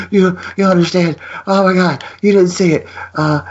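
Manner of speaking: high-pitched, stammering mock hysteria; retold frantic explaining. Husband tells wife the dog got stuck, high mock-frantic. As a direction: replay yourself barely getting the words out. Retelling himself losing his mind.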